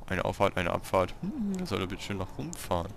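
usual speaking voice

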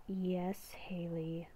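hushed, slow whisper of an inner beast; beast within voice. Acted. Inner beast whispering a warning to Haley, hushed and ominous. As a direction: whisper like a creature living inside her head.

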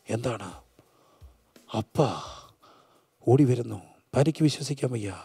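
low, soft, with long pauses; hushed preacher storytelling. Acted. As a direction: tell it gravely, almost in a whisper. Hushed dramatic storytelling in sermon.

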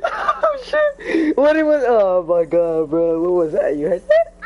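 high, loud, laughing outburst; real shocked laughter, loud and high. Real, not acted. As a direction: react wildly to a jump scare. Jump scare in game, laughing.

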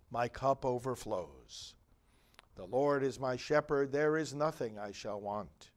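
measured, solemn psalm recitation with a refrain; psalm read at a funeral mass; responsorial psalm. Acted. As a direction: lead the congregation through the psalm.

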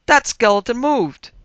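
loud, high, alarmed cry; alarmed shout. A narrator's reading. Cop telling the nephew the skeleton moved, loud and alarmed. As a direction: yell it, spooked.

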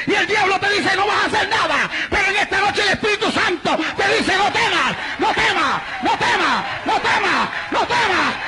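high, shouted exhortation; full-force preaching. Real, not acted. Preacher tells believers the Spirit says fear not, fervent shouting. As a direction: drive it up into a pounding repeated cry.

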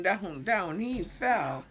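ordinary delivery

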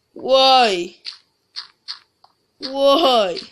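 loud exasperated cry; fed-up gamer yelling at the screen; frustrated why. Real, not acted. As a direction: shout it out at the dead end. Frustrated at another dead end.

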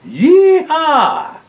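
high, loud whoop of joy; gleeful cowboy yell; yeehaw cheer. Acted. Driver's shout in story.

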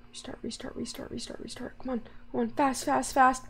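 hushed, rapid urging under the breath; urgent whisper. Real, not acted. Player at the generator urging it to restart, urgent whisper. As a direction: whisper it anxiously, hurrying the machine.

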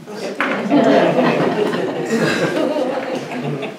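high, rolling laugh; long laugh. Real, not acted. Preacher laughing at his own suggestions to God, high and long. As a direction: laugh at your own confession.

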